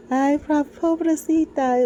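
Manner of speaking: high, slow sing-song Venezuelan lilt. Retold or imitated. Imitating the woman at the zoo, high sing-song Venezuelan. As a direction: coo "Ay, pobrecita" like a Venezuelan woman. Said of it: "she was just like".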